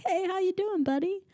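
high, sing-song buddy voice; friendly good cop. Retold or imitated. Good cop greeting Emmett, high and chummy. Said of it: "And he's like".

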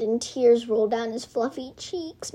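usual speaking voice

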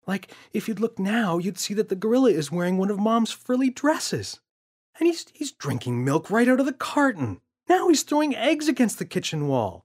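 put-on boy's voice. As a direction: kid reporting the mess, building up. James describes gorilla's antics.

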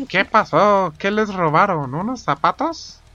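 questioning engineer. Retold or imitated. Engineer asking what they stole, put-on questioning voice.

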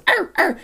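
high yappy mock bark; dog barking. Old dog barking at noises, imitated in a high yap. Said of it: "that dog goes".

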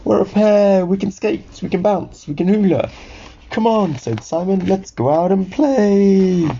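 high, eager little sock; excited invitation; delighted character voice. Acted. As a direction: pitch it up, bursting to play. Simon delighted at finding pair.

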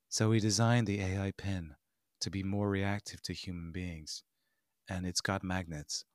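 low, soft, deadpan presenter impression; imitating a lifeless tech presenter; no charisma, monotone. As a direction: drain out every bit of excitement. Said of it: "there's no charisma, no excitement whatsoever".

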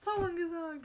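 put-on high, loud tattling child; whiny Dudley voice. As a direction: tattle like a spoilt kid. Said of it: "jetzt fängt Dudley an".

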